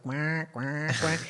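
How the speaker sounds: soft, low imitated quacks; duck alarm. Recalling the sound that makes her laugh, soft quacking. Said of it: "that sound makes me laugh".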